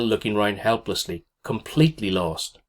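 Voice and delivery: ordinary delivery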